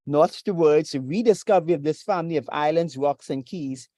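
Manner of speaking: ordinary talk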